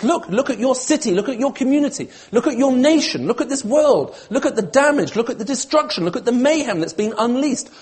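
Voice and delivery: high, loud, urgent appeal; impassioned pleading. Acted. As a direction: implore them, hammering each repeated phrase. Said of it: "God is pleading with his people here".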